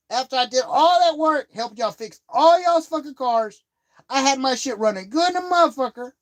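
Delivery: confronting the cousin about his wrecked car, furious and high; retold angry rant.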